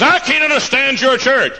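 high, loud mock protest; baffled objector. Outsider who can't follow the joyful church, high mocking protest. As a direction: imitate a critic protesting at full voice. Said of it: "He says".

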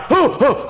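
high, loud comic "hoo, hoo"; hammed-up panicky yelps; mock pained hooting. Preacher mimics someone with heart pain, mock panicky hoots.

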